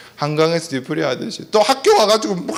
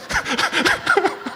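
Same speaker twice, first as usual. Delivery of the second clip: burst of laughter; 14 semitones higher, 3 dB quieter than usual. Real, not acted. Laughing at own demo.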